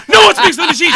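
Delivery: high, loud acted shout; gangster's loud insistence. Gangster defending his friend to the teacher, loud. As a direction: bellow it in mock outrage.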